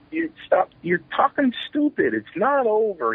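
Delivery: high-pitched, insistent objection; retold protest. Retold protest to friend giving up.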